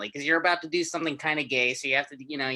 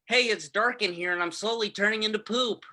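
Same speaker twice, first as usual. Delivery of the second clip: breakfast impression; 7 semitones higher than usual. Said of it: "impression of my breakfast".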